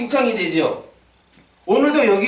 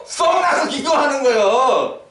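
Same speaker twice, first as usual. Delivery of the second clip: exclaiming; 5 semitones higher, slower than usual. Acted. Pastor exclaims.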